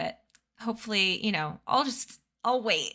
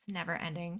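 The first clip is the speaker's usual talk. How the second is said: weary quiet; 4 semitones lower, 9 dB quieter, much faster than usual. Shown as a demonstration. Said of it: "quieter repeat".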